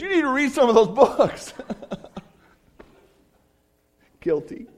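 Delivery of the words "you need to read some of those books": loud, high imitation of an exuberant friend; booming, over-the-top urging; enthusiastic mock scolding. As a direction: shout playful advice as the friend would. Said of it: "he's a real enthusiastic guy".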